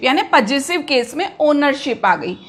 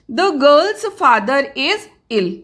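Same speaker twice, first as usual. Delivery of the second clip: dictating slowly; much slower than usual. Shown as a demonstration. Teacher reading an example sentence.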